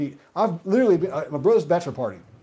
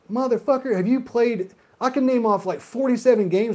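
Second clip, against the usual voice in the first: high mock yell; 8 semitones higher than usual. Retold argument with Xbox fan.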